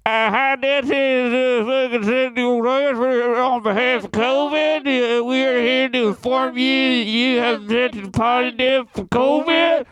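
put-on high, Southern-accented call center voice; imitating Southern caller. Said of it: "She goes".

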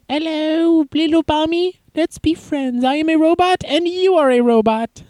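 high, sing-song robot voice. Retold or imitated. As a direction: play a friendly toy robot. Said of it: "the robot goes in and goes".

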